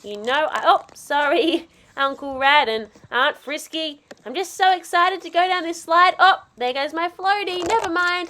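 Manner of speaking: ordinary talk, nothing marked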